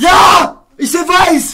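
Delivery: loud, high taunting shout; gleeful-loud mocking retort; shouted comeback. Real, not acted. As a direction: yell it back to tease. Teasing friend with loud comeback.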